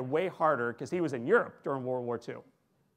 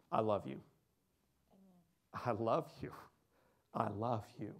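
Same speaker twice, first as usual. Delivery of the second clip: soft, hushed, lower I love you; tender repeat; 4 semitones lower, 7 dB quieter than usual. Retold or imitated. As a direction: say it gently, each time softer.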